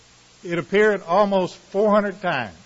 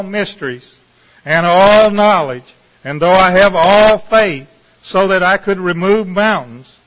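Scripture reading; 5 dB louder than usual. Acted. Preacher reads the love chapter to the congregation, solemn Scripture reading. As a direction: proclaim each phrase, pausing between, then ease down.

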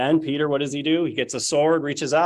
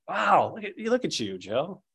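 higher, impressed mother's voice; delighted mom; 6 semitones higher, 3 dB quieter than usual. Retold or imitated. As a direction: gush over the gift like mom. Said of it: "My mom at first loved it".

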